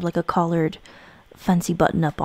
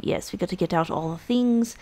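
usual speaking voice

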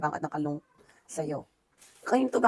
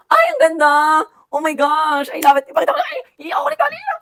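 louder, much higher squeals of joy that climb to the top; overjoyed squeal; 8 semitones higher, 7 dB louder than usual. Real, not acted. Vlogger overwhelmed by a photo gift, squealing with joy. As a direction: gush over the gift, near happy tears.

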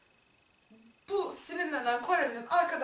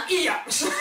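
louder, breaking into laughter; gleeful retort; 4 semitones higher, 7 dB louder than usual. Retold or imitated. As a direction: blurt it out proudly, about to crack up. Gleeful retold retort, bursting into laughter. (kahkahaya boğulurken yüksek sesle)

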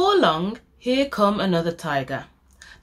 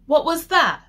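startled fright; 9 semitones higher, 5 dB louder than usual. A narrator's reading. Sam startled by growl.

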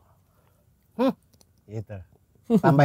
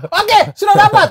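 1 quoted director call; 11 semitones higher, 7 dB louder than usual. Retold or imitated. Quoting director's satisfied call.

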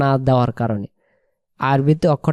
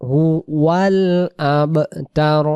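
Quran recitation; 3 semitones higher, much slower than usual. Shown as a demonstration. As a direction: chant the final verse, drawing out the vowels. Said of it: "প্রথম আয়াত".

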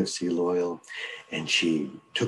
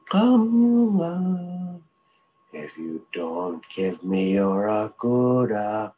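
high, loud menacing chant from outside; monster voice; 3 semitones higher, 3 dB louder, much slower than usual. Acted. Creature outside the door threatens the mink, menacing chant. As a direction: threaten her like a hungry creature.

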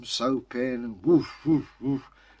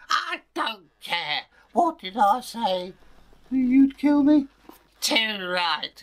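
high, slow threatening mother voice; a cold mother confirming her threat; menacing mother; 10 semitones higher, 6 dB louder, slower than usual. Acted. Mother threatens daughter in a high slow voice.

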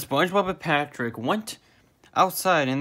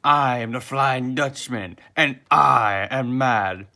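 slow boom, building louder; 6 dB louder, slower than usual. A narrator's reading. Flying Dutchman confronting the party, slow booming voice. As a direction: boom it out slowly to the last word. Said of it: "in a booming voice".

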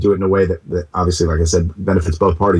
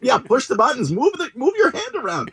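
laughing instruction; 3 dB quieter than usual. Retold or imitated. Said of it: "I'm like".

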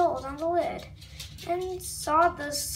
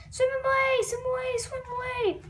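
high panicked cry; 7 semitones higher than usual. Acted. Fish fleeing octopus.

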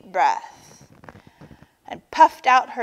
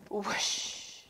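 soft, airy whoosh; long exhaled rush of air; breath let out; 13 dB quieter than usual. A narrator's reading. Turtle releases her held breath, whooshing out. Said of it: "let out her breath with a".

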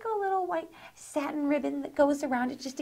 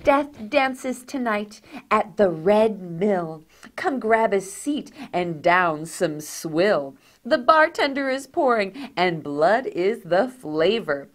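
spooky reading; 4 semitones lower, 3 dB louder, slower than usual. Shown as a demonstration. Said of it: "let's read her little chipboard".

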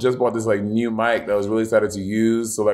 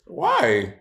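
shocked high scold; slower than usual. Real, not acted. Scolding Bob.

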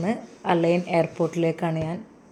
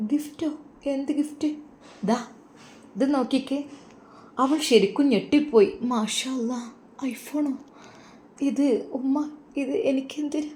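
put-on surprised young woman; 7 semitones higher than usual. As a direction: gasp at the gift, higher and hushed. (അത്ഭുതപ്പെട്ട പെൺകുട്ടിയുടെ ശബ്ദം)

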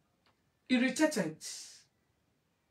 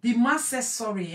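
ordinary delivery

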